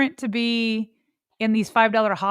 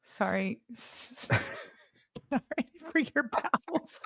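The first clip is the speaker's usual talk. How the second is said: whispering through suppressed laughter; stifled laugh; 13 dB quieter, slower than usual. Real, not acted. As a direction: hold back a laugh while apologizing. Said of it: "I was trying not to laugh".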